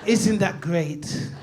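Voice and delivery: ordinary talk, nothing marked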